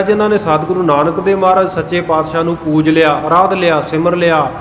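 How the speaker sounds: normal talking voice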